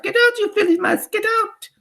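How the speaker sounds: witch mimicking frantic teachers; very high, loud mock panic; mock shouting. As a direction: shriek it like a teacher up on a desk. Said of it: "upon the desks and shout".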